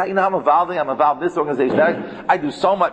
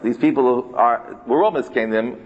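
usual speaking voice

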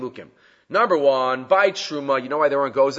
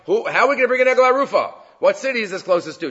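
a hint of a sarcastic wailing rabbi, higher and louder, fast; mock sarcastic cry; 8 semitones higher, 4 dB louder, faster than usual. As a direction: lightly mimic a sarcastic lament. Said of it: "Just sarcastically, he's, he's crying".

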